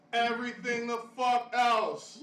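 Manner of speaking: loud and slow, leaning on every word; emphatic punchline. Real, not acted. Comic hammering the punchline home to the crowd, loud and slow.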